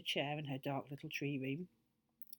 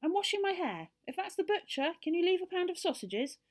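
high, loud call through a door; high-pitched fairy shout; shouting from indoors; 12 semitones higher, 6 dB louder than usual. A narrator's reading. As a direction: call out busily to a tradesman. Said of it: "The voice shouted again".

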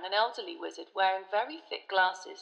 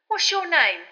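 louder, higher, insistent question; persistent; 9 semitones higher, 10 dB louder than usual. A narrator's reading. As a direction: keep pressing for his name. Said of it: "Stan persisted".

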